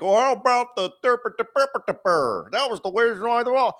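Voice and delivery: high, goofy mocking voice; mocking troll voice. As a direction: mimic a whiny internet troll. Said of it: "all you trolls out there start freaking out".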